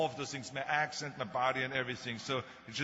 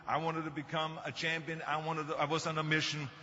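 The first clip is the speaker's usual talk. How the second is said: ordinary talk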